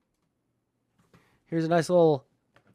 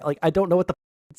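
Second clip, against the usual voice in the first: ordinary talk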